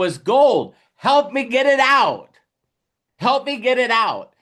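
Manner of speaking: rally them to unload fast; excited. Pretend.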